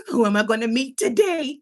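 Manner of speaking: loud, playfully eager anticipation; acted eagerness. Streamer acting out pressing go live, giddily eager.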